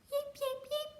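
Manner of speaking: very high, tiny chick yips; high hatchling peeping; baby bird peeps. A narrator's reading. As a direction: squeak like newborn birds.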